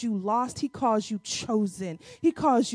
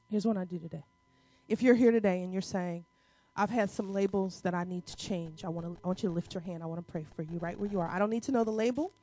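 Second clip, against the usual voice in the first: soft, hushed prayer over the room; prayer leading; 6 semitones lower, 8 dB quieter than usual. Acted. Pastor praying softly over the congregation with hands lifted. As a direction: pray over them quietly and tenderly.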